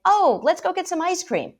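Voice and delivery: high, loud breezy dismissal; cheery brush-off. Retold or imitated. Parent brushing off a sad child, high loud cheery brush-off. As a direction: chirp it to skip past the feeling.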